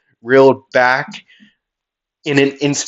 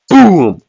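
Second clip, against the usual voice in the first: punchy shouted boom; loud boom; 8 semitones higher, 8 dB louder than usual. Retold or imitated. Narrating the punch landing, a loud boom. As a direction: slam the impact sound out. Sound effect of impact.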